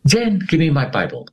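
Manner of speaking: mild retold shout to his wife; retold scream. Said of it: "I screamed".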